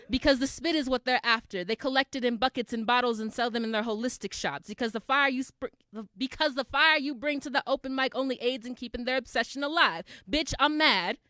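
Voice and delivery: projected, insistent slam rhythm; emphatic stage poetry, building to the refrain; angry spoken-word poem. Acted. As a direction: press each accusation on the audience.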